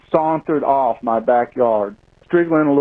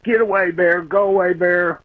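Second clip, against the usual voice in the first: retold yell; 5 semitones higher than usual. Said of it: "and yelled".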